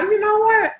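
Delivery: high, proud declaration; confident self-affirmation. Retold or imitated. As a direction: proclaim it to yourself. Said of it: "I be like".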